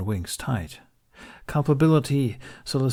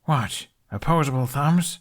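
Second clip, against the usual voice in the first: higher, scornful quip; scoffing; 6 semitones higher, 4 dB louder than usual. A narrator's reading. Hiccup mocking the dragon's praise, scornful and higher. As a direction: scoff at the idea. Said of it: "Hiccup scoffed".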